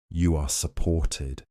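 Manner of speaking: plain reading voice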